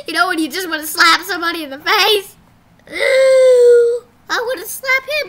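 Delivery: loud, fast exasperated rant; frustrated outburst. Real, not acted. Kid venting that he wants to slap the cop. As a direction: blurt it out, fed up.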